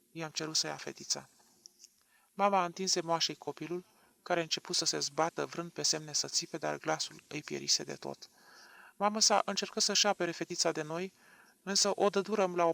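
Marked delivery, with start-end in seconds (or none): none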